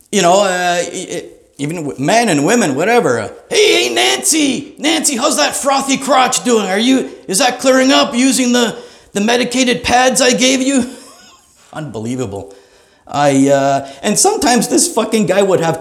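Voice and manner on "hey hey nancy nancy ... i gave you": booming doctor shout. Retold or imitated. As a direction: shout it across the clinic. Loud doctor voice, shouting privates.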